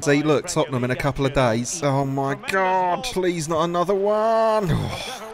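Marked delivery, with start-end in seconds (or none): 1.8-5.2 slow, drawn-out groaning dismay; anguished slow wail; despairing plea. Real, not acted. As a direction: drag the words out as hope goes. Watching another goal go in.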